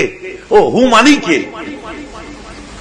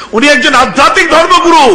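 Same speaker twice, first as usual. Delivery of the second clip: raised, louder pitch for the crowd; emphatic preaching; 12 semitones higher, 10 dB louder than usual. Real, not acted. Preacher stressing to the crowd, raised loud voice. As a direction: drive the point home to the listeners.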